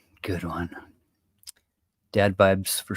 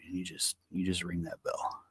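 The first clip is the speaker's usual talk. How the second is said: much softer, dropped voice; hushed instruction to the bartender; quiet aside; 5 semitones lower, 17 dB quieter than usual. Acted. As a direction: say it under your breath. Quiet line in joke.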